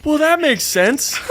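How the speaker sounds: high, drawn-out realization; slow, high-voiced agreement; dawning acceptance. Retold reaction to talking bird.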